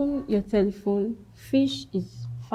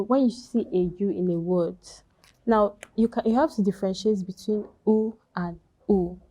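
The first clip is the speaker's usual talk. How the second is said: ordinary talk, nothing marked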